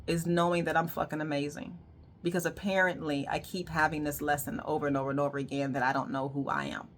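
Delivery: dropping her voice to confess; low murmured admission; quiet aside. Real, not acted.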